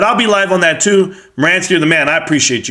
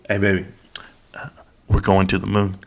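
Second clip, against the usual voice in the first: much lower and softer, an intimate murmur; pillow talk; 10 semitones lower, 7 dB quieter than usual. Retold or imitated. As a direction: hush it down like sweet nothings to your wife. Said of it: "my pillow talk to my wife".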